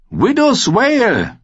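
high, drawn-out royal cry; high boy-king call. A narrator's reading. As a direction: proclaim the sword's name slowly, pitched high. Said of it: "rief er".

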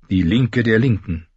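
plain reading voice

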